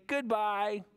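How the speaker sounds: high, slow waving goodbye; high slow goodbye to the lions; sing-song goodbye. Pretend. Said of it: "and we say".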